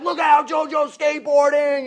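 high, very loud excited yell; shouted warning. Retold or imitated. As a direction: holler to clear the way. Shouting warning through dining room.

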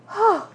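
high, breathed-out "oh" of relief; sigh of relief. Acted. Introvert alone in her room at last, relieved sigh. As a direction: let out an acted sigh of relief.

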